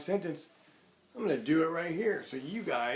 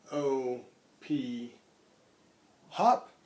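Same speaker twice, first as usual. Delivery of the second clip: put-on spelling aloud to children; much slower than usual. Shown as a demonstration. Spelling out the week's words.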